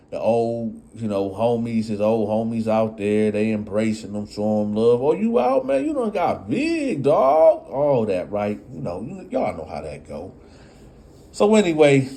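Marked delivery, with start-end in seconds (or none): 5.0-7.7 high, hyped-up homie greeting; mock hype welcome; excited street greeting. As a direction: greet him like he just got home.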